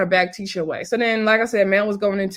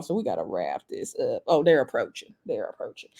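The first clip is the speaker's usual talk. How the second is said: quiet aside; 9 semitones lower, 6 dB quieter than usual. Real, not acted. Food arriving, drops voice.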